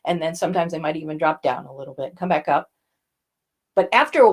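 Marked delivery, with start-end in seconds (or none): 0.0-2.7 low, quick one-note drone; monotone. Shown as a demonstration. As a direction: rattle it off on one flat note like a monotone speaker. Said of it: "that one note that they stay on".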